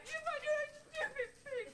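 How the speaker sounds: high, upset yell; furious outburst. Acted. Helper quitting on the puppeteer, furious. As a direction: explode and throw the job back.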